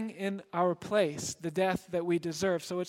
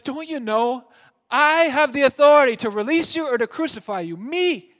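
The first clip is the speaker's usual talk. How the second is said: loud, high, indignant; angry boast; 7 semitones higher, 11 dB louder than usual. Acted. Pilate angrily reminding Jesus of his power.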